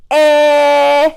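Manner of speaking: long held "eh" wail; mock baby bawl on one note; pretend baby cry. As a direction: drag out the short vowel like a crying baby. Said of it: "pretend like it's a baby crying".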